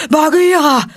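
high, loud breathless call; panting shout. A narrator's reading. Baloo shouts to Bagheera, panting and loud. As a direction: gasp out the name as a cry. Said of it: "schrie er keuchend".

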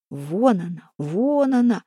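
drawn-out call as if from a distance. A narrator's reading. Харапун, out of sight, points out the runaway, calling out. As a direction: call it out twice, stretching the first word. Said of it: "кричал где-то невидимый Харапун".

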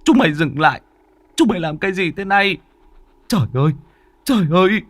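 high, desperate furious shout. Acted. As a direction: yell at the bandits in horror. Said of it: "Ông quát".

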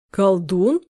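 puzzled rising question. A narrator's reading. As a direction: ask it baffled. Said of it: "недоумённо спросил Никита".

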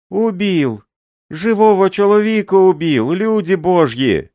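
high, loud anguished cry; scream for help. A narrator's reading. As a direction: cry out that he is killed. Old man screams for help.

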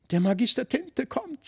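put-on a boy's alarmed call, held back; frightened cry. A narrator's reading. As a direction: Felix crying out in fear. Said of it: "schrie Felix".

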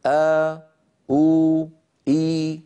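three long vowels آ, أو, إي each held out; dictating the vowels آ أو إي; sound demo. Shown as a demonstration. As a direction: sing out آ أو إي one by one. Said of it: "واحفظوا هذه الأصوات الثلاثة".

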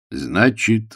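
ordinary delivery

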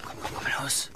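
urgent whisper to the flame. Acted. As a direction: coax it under your breath. Coaxing the dying ember.

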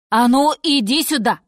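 lightly voiced gruff order; commanding walrus. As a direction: order a youngster over.

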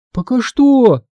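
put-on high, small child's question; thin little voice. A narrator's reading. Scared Todd asks his brother to go on, thin high voice. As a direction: pipe it up in a thin voice. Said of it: "тонким голоском".

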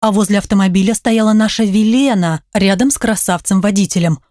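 usual speaking voice

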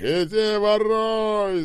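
high, drawn-out shout. A narrator's reading. Скоба screaming his catchphrase in the cabinet, shouting. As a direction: yell it long, loud and high. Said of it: "закричал Скоба".

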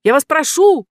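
very high, loud squeak; squeaky little man. Acted. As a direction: squeal like a tiny green man. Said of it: "пискнул зелёный человечек".

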